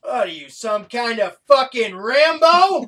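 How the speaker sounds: mock him like a hammed-up cop; loud slowed movie-line delivery; mock tough cop. Quoting the cop's Rambo line.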